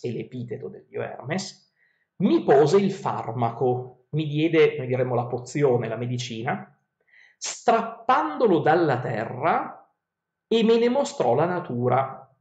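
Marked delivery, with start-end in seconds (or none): 2.2-12.3 epic verse reading. Acted. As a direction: read the verses like a storyteller. Reads Odyssey verses aloud.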